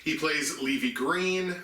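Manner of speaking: ordinary talk, nothing marked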